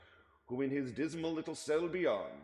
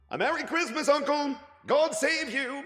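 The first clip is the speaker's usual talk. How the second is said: high, loud joyful greeting; cheerful cry; 11 dB louder than usual. Acted. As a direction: call out a bright Christmas greeting. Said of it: "cried a cheerful voice".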